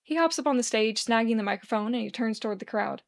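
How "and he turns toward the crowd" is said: ordinary delivery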